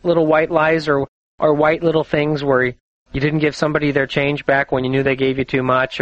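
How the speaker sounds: ordinary talk, nothing marked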